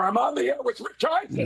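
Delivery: real tears, struggling for composure; apologizing through tears, pitch climbing at the end; crying. Real, not acted. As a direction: push through the choked-up feeling. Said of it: "I'm sorry for crying".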